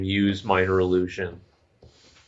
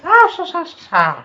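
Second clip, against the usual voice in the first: put-on Irish accent; 8 dB louder than usual. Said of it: "Are you talking with an Irish accent?".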